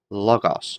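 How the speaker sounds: "Logos" stressed on LO, falling away after it; stress on first syllable. Shown as a demonstration. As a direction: hit the LO of "Logos". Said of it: "make sure the accent's on that first syllable".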